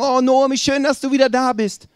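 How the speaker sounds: high, loud, gushing welcome; delighted greeting. Acted. Townspeople to Naomi returning after years, excitedly welcoming. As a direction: greet a long-lost friend with excitement.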